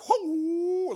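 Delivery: high drawn-out "whoo" of alarm; startled whoop. Retold or imitated. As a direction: whoop as if someone is charging at you. Startled as man runs at him.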